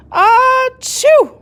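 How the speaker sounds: very high, loud sneeze; huge sneeze. A narrator's reading. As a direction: explode into a sneeze. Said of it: "a big one".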